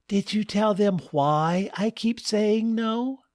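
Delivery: high, pointed wife tone. Acted. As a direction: challenge the husband coolly. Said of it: "then said".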